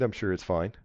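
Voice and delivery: usual speaking voice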